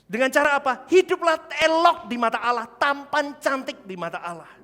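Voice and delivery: loud high preacher's cry; fiery sermon shout; preaching at full force. Real, not acted. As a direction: thunder it out to the church.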